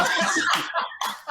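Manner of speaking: a real laugh, airy and high; giggling laugh; high breathy laugh. Real, not acted. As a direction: burst out laughing. Laughing at his own story.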